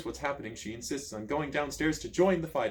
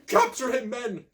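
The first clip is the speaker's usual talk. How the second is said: shouted order; 10 semitones higher, 5 dB louder, slower than usual. A narrator's reading. Prince ordering the guards.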